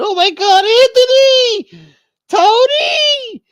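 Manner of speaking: high, loud hammed-up wife's scream; screaming Carmela. Wife screaming over her shot husband, hammed-up. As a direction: shriek the husband's name in panic. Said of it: "Carmela started screaming".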